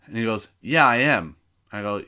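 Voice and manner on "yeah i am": louder, defiant snap; a teacher insisting on his authority; loud mock teacher retort. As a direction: bark it back like the stubborn teacher. Teacher's retort, acted quarrel.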